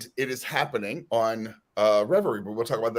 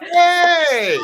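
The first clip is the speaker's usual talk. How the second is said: excited greeting; 9 dB louder than usual. Real, not acted. Greeting arriving guest loudly.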